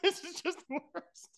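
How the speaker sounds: high, soft, slow words squeezed out through laughter; barely getting it out while laughing; laughing hard. Real, not acted. Host cracking up at his guest's embarrassment, laughing. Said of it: "Oh my God, I'm crying".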